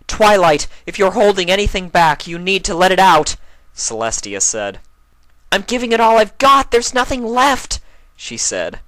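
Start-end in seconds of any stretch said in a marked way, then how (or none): 0.1-3.4 high, loud regal princess voice; a commanding princess pleading with all her might; Celestia urging. Acted. Celestia urging Twilight to let it all out, high and strong. 5.5-7.8 high, loud straining mare voice; strained Twilight. Acted. Twilight answering Celestia while straining, high and loud.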